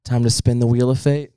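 low announcer voice; game show host. Pretend. Host introducing the wheel of fate, low announcer voice.

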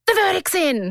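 high-pitched excited news flash; imitating an excited office announcement; announcing big news. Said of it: "someone up in the listener office who was like".

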